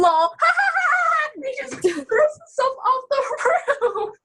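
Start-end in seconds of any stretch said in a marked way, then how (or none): 0.0-1.3 mock laugh. Mocking laugh after quoting villain.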